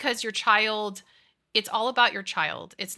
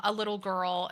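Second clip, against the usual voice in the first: ordinary talk, nothing marked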